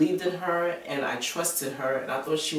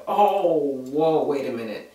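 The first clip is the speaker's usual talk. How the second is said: retold shock; 6 dB louder than usual. Reacting to her friend's confession, shocked. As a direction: stop them short, stunned and louder. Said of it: "I was in shock".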